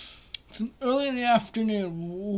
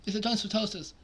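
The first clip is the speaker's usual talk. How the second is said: normal talking voice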